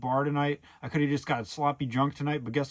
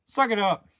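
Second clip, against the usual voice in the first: louder, higher brush-off; mock tough-guy snap, pitched up; dismissive retort; 7 semitones higher, 5 dB louder than usual. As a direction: shut him down with a loud scoff. Retorting to whiny coworker.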